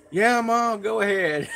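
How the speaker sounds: loud, high holler to another room; calling back. Retold or imitated. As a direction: shout permission back to Mom.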